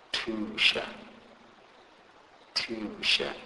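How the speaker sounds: "tusha" opening with the T of take; "tusha" said as a teacher's example word, puffed T; TH as plain T. Shown as a demonstration. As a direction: model "tusha" carefully, T as in English take. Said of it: "pronounced like the T in the English word take".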